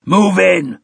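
high, loud screamed order; screaming. A narrator's reading. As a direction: scream the command. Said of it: "someone was screaming".

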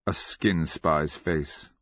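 ordinary delivery, nothing marked

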